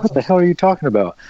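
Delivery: mock bafflement. Retold baffled reply of optometrist.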